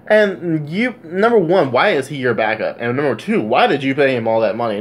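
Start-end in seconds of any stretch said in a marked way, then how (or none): none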